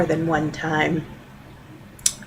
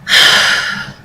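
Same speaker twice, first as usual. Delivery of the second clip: loud, audible huff of annoyance; breathy exasperated exhale; exasperated sigh; 3 dB louder than usual. Real, not acted. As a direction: let out a big fed-up sigh. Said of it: "an exasperated sigh".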